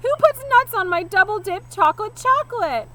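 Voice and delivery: screamed. A narrator's reading. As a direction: shriek in outrage at the nuts. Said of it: "he screamed".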